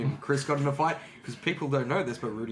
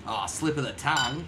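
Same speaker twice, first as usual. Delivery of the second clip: bad Australian accent. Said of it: "do an American doing a bad Australian".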